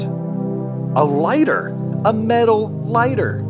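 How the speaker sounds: drawn-out, rising excitement; amazed discovery. A narrator's reading. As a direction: marvel slowly at an unbelievable find. Finds a lighter, amazed.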